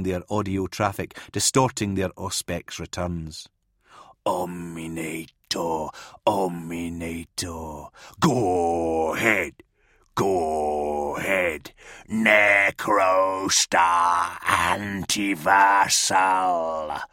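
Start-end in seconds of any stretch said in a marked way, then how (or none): 4.2-5.9 slow, menacing whispered demon name. Acted. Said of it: "howl out their names, throaty and malevolent". 6.3-7.9 slow, low voiced demon chant; demon howl. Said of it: "howl out their names, throaty and malevolent". 8.2-17.0 slow, low, building to a demonic shout; throaty demon howl. Acted. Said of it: "howl out their names, throaty and malevolent".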